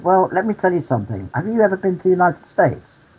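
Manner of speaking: high-pitched retold challenge; acted pointed questioning. Storyteller replays quizzing the cleaner on travel, pointed questioning. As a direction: pitch it up, leading the listener to a point.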